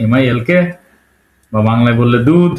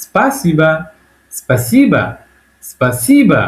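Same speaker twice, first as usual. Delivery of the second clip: "স্পাসিবা" with stressed, raised "si" falling onto a short "ba"; Russian model pronunciation; much slower than usual. Shown as a demonstration. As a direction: punch the middle of "স্পাসিবা", then drop. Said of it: "সবসময় রাশিয়ানরা বলে".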